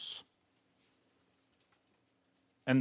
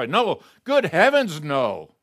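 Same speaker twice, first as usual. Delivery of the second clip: higher, louder shocked no; loud exclamation; 3 semitones higher, 7 dB louder than usual. Real, not acted. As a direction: cry out in horrified denial. Preacher rejects the objection.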